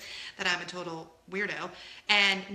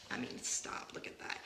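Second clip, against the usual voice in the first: hushed awe; 12 dB quieter than usual. Real, not acted. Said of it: "awed aside".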